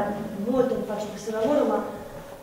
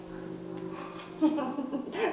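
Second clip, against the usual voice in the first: breathy laugh; 3 semitones higher, 9 dB quieter than usual. Acted.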